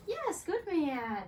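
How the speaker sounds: slow, drawn-out high praise. Real, not acted. Trainer slowly praising her dog.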